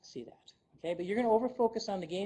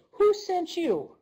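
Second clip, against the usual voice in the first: indignant challenge; 10 semitones higher, 8 dB louder than usual. Retold or imitated. Colleagues to returning delegate, loud indignant demand. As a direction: hurl the question back, affronted and loud. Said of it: "You say".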